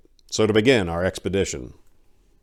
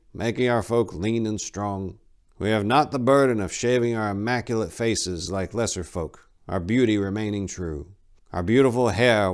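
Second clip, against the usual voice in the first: raised, solemn storytelling monk; old monk reciting; 4 semitones higher than usual. Acted. Old monk retelling the god's story to pass guard duty, solemn. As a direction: recite the creed like an old habit.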